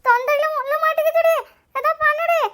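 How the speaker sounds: high-pitched choking wail; panicked pleading. Acted. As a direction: beg desperately for help. Bone stuck, begging for help. (தவித்து உதவி கேட்கும் குரல்)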